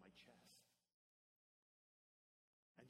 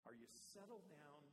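normal talking voice

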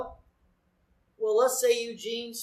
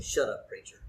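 lower, laughing mock grumble; grumbling listener; 10 semitones lower, 5 dB quieter than usual. Congregation voiced telling the preacher off, low mock grumble. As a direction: growl it like a fed-up churchgoer, laughing. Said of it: "They're saying".

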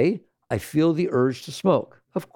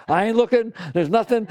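much higher and louder, a sudden firm refusal; defiant exclamation; 8 semitones higher, 4 dB louder than usual. Retold or imitated. Prisoners refusing the teacher's offer, loud and defiant. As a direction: blurt it out loud and proud.

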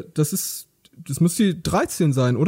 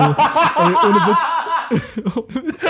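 laughing; 10 semitones higher, much slower than usual. Real, not acted. Storyteller laughing at his own embarrassment. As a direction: crack up while insisting. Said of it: "laughing at own story".

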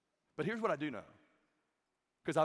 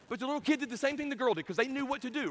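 ordinary delivery

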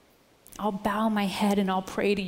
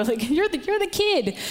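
amused disbelief; 8 semitones higher, 4 dB louder than usual. Retold or imitated. Disbelief at young exterminator.

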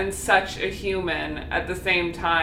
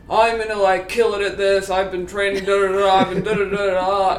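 mocking boastful dudes; 3 semitones lower than usual. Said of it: "boastful and so overly confident".

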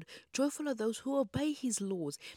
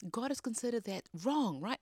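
usual speaking voice

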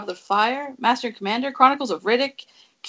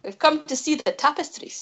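higher-pitched mock Scottish accent; fake Scottish accent; 6 semitones higher, 4 dB quieter than usual. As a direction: quote it in a deliberately bad Scottish accent. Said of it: "he did the fake, uh, Scottish accent".

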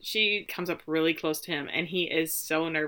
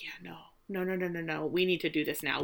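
much softer, low-key rapid nos; hushed urgent insistence; 3 semitones lower, 8 dB quieter, faster than usual. Retold or imitated. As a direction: insist under your breath. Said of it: "And she's like".

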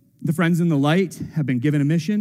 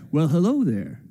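casual greeting. Pretend. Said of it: "something akin to".

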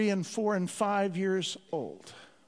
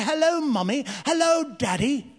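mock-posh British greeting; British accent; 3 semitones higher than usual. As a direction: play at being very British at teatime. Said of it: "with a British accent".

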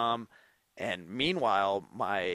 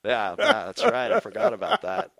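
high, louder, laughing through the words; 9 semitones higher, 6 dB louder than usual. Real, not acted. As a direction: laugh as it comes back to you. Remembering, laughing.

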